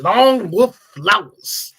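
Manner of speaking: high, loud, slow swooping cheer; playful hype announcement. Pretend. Host crowning himself with a nickname, loud drawn-out cheer. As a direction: announce it like a champion's ring name, stretched out.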